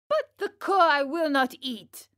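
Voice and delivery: put-on high, loud childish refusal; angry cry. A narrator's reading. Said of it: "cried Pinocchio in an angry tone".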